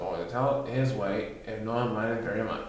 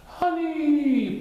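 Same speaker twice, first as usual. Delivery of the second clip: loud, high booming honey; loud drawn-out high call; 4 dB louder than usual. Acted. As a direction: boom to remind them. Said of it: "boomed Frankenpoo".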